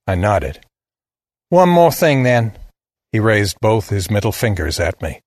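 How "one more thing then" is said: put-on higher southern boy, defiant; one last challenge in a Southern drawl. A narrator's reading. Boy with a final word for his killer, southern drawl. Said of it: "He had a deep southern drawl".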